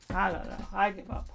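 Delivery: ordinary talk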